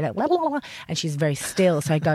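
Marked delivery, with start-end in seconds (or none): 0.1-0.6 high-pitched babbled blah-blah; all-over-the-place high blabber; wobbly blabbering. Acted. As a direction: babble loosely, voice swooping way up. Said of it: "Like, I'm very".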